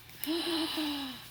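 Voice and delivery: sharp intake of breath in awe; real gasp of wonder at the present; delighted gasp. Real, not acted. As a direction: gasp as the gift appears. Unwrapping a gift.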